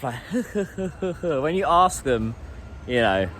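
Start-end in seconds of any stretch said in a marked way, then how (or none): 0.2-1.4 a short, embarrassed demo laugh, breathy and quick; awkward little laugh. Shown as a demonstration. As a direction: imitate the shy laugh people give when asked. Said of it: "They always do that little laugh".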